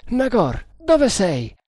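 high searching call. A narrator's reading. Tremal Naik searches for Nagor, calling out. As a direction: call out high to someone out of sight. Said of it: "chiese il cacciatore di serpenti".

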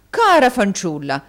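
loud echo of his wife's surprise; effusive husband's exclamation; exclaiming. A narrator's reading. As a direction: cry it out with a punched first word. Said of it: "esclamò Mantalini".

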